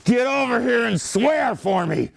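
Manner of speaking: impersonated yell. Retold or imitated. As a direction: yell it like an exasperated Prussian drillmaster. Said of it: "he would yell to him in French".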